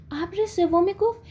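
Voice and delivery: usual speaking voice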